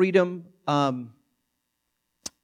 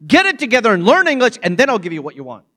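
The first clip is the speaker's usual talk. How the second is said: high, loud, harsh scolding parent; wicked stepmother; 7 semitones higher, 8 dB louder than usual. Pretend. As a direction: berate the toddler with contempt. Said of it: "I didn't go".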